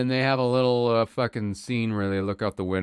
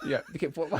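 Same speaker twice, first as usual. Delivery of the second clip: ordinary talk, nothing marked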